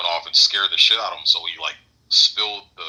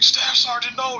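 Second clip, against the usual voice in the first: high frantic yell of alarm; panicked cry; slower than usual. Retold or imitated. Marine rushing to his wounded staff sergeant, panicked cry.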